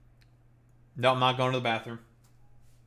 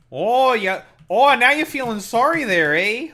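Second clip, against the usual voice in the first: loud, much higher hammed-up Canadian accent scolding; mock Canadian accent; 11 semitones higher, 9 dB louder than usual. Streamer scolds chat in mock Canadian accent. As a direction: stretch the Canadian oh and eh, teasing.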